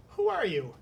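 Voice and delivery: a touch of surprise in the voice; light surprised question; surprised princess. Acted. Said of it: "she asked, very much surprised".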